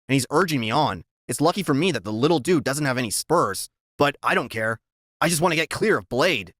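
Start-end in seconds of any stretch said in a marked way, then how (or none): none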